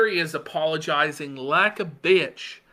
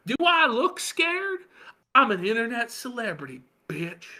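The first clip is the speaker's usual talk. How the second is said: mock award-winning self-importance; mocking Ralph; 6 semitones higher than usual. Host awarding Ralph's quote, high mocking voice. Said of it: "least self-aware statement of the year".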